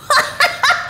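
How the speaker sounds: high ringing peal of giggles; child's pealing laugh; childish laughter. A narrator's reading. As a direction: laugh very high like a tickled little boy. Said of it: "ringing peal of childish laughter".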